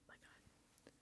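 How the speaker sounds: tense, nearly silent gasp of words; breathless whisper. Real, not acted. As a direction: hush it out in suspense. Nervous during vote reading.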